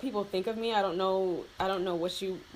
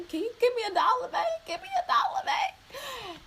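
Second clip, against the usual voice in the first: much higher, fast wheedling voice; sweet-talking; 4 dB louder than usual. Retold or imitated. Asking dad for money in a high, sugary wheedle. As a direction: coax him like a cute begging kid. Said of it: "I asked my dad".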